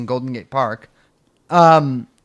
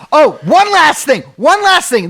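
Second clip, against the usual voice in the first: oh, one last thing, jumping up high and loud; sudden remembering; 11 semitones higher, 6 dB louder than usual. Real, not acted. Host suddenly remembering one more story for co-host, high and loud. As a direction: suddenly recall the last item.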